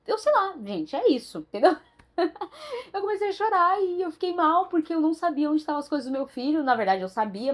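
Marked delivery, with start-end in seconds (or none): 2.2-2.9 a burst of high-pitched laughter. Real, not acted. Laughing at her own postpartum tears.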